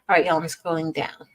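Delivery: normal talking voice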